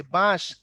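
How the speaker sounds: "বাঁশ" punched out with a long aa; stretched, emphatic "বাঁশ"; long vowel. Shown as a demonstration. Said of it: "আ লম-, লম্বা হইছে".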